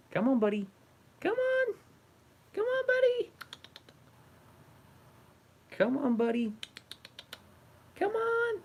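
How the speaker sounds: very high sing-song coaxing; high coaxing pet voice. Real, not acted. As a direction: lure the cat with a squeaky high call. Coaxing the cat onto desk.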